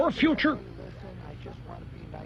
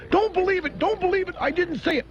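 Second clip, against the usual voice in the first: high, whiny pleading imitation; mock begging; 12 semitones higher than usual. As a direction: beg the crowd, pitched way up. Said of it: "begged people".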